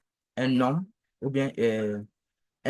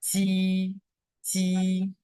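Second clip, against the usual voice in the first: "ti" high, long and level; sustained, unbending high "ti"; first tone; 4 semitones higher than usual. Shown as a demonstration. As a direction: hold "ti" on one high note. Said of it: "le ton".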